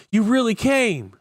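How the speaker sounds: high, loud exclamation; excited shout. A narrator's reading. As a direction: cry it out at the top of your pitch. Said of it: "Jake shouted".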